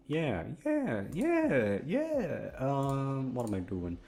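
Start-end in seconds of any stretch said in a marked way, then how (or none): 0.1-2.5 yeah. Pretend. Rising sing-song yeahs.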